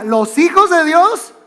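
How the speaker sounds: "Los hijos de Dios" high, slow and rising, left open; prompting mother. Retold or imitated. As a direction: imitate mom cueing "Los hijos de Dios" loudly for completion. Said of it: "nosotros teníamos que completar toda la frase".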